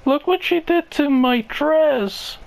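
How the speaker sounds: put-on high, complaining girl voice; high whiny girlfriend. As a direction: whine it to the boyfriend. Girlfriend upset about spill.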